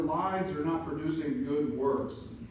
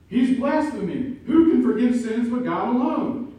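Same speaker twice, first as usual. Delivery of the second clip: much higher, much louder scandalized cry; furious shout; 9 semitones higher, 11 dB louder than usual. Acted. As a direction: shout the accusation in outrage. Scribes' accusation voiced again.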